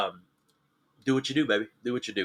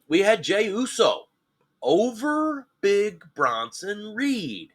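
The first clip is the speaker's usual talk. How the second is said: hyped announcement; 9 semitones higher, 3 dB louder, slower than usual. Real, not acted. Announcing a surprise result.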